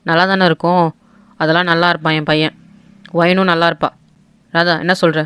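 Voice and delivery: deep, commanding uncle laying down the law; stern patriarch. Acted. The uncle ordering his sister to marry off the girl, low and stern. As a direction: speak low and firm, brooking no argument.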